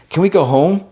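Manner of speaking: a frightened little plea; high pleading voice. Retold or imitated. Ghostly wife pleading.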